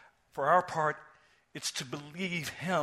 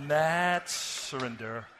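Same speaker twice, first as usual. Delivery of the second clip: mad surrender; 3 semitones higher, 8 dB louder, much slower than usual. Real, not acted. Punchline repeated for emphasis.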